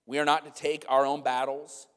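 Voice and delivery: normal talking voice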